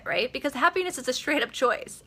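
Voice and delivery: usual speaking voice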